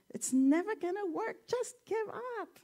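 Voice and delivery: high mock frog voice telling them to quit; discouraging frog. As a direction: call down high and scornful, as a know-it-all frog. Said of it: "the frog who's on the top says".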